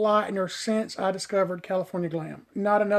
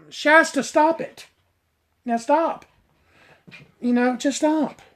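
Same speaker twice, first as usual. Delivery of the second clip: scolding the dog; 6 semitones higher than usual. Real, not acted.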